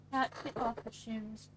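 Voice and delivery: a strange comic aside; odd put-on voice. Odd comment read in other voice.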